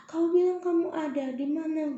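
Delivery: high, loud cry to God; retold desperate cry. Alone on the pier crying out to God, high and desperate. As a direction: call out to God in despair. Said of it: "Aku sampai ngomong kayak gitu".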